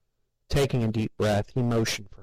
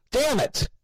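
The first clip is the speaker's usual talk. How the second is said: shouting. A narrator's reading. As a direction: shout it in frustration. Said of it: "he shouted".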